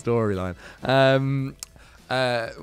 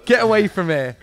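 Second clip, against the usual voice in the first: loud; 6 semitones higher, 7 dB louder than usual. Real, not acted. Teasing friend, mock dismissal.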